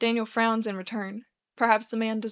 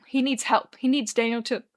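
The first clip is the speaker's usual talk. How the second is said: usual speaking voice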